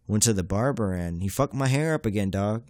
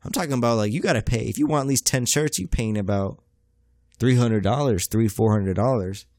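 normal talking voice

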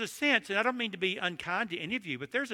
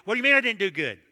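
loud, higher, defensive comeback; indignant retort; 4 semitones higher, 7 dB louder, faster than usual. Retold or imitated. Preacher challenging his wife's verdict, loud mock indignation. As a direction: re-enact an affronted husband firing back. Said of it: "So I fired back".

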